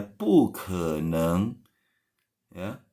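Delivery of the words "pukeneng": pukeneng sangat pelan, nada naik; slow rising pukeneng. Shown as a demonstration. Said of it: "pukeneng bukan pukeneng ya". (pukeneng pelan dengan nada naik)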